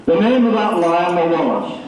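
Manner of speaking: projected to be heard. Real, not acted. Repeating the line for an audience that couldn't hear, projected. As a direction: lift it for the back of the room. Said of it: "Do I need a microphone?".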